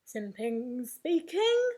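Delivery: slow, drawn-out, self-important phone greeting; important telephone voice. Acted. Father answering the telephone, very important voice. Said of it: "in his very important telephone voice".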